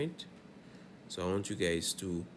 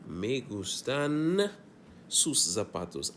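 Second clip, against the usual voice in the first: slow model reading; 5 semitones higher, slower than usual. Shown as a demonstration. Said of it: "So it would actually read like this".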